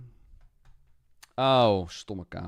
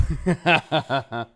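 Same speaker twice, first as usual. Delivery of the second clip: delighted laugh; 5 semitones higher, 7 dB louder than usual. Real, not acted. Delighted at found character.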